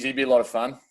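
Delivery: ordinary talk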